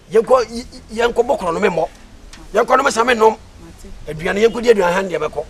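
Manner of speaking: excited urging. Acted. Excited urging, rising loud.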